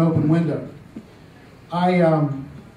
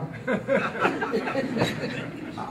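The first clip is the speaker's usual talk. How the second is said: airy unvoiced chuckle at the reveal; breathy laugh; 5 semitones higher, 7 dB quieter than usual. Real, not acted. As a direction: let a soundless laugh escape. Punchline of the burglary story.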